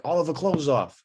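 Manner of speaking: mock calling a name across a bar; hammed-up cartoon prank-call victim, pitched high; Moe. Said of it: "Yeah, with Mo's accent".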